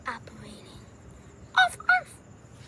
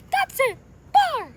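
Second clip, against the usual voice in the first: high, loud, fed-up goodbye; worked-up cartoon huff; huffy exit; 9 semitones higher, 8 dB louder than usual. Acted quarrel, character storms off.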